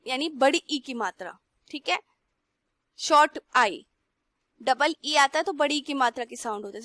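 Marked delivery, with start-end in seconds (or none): none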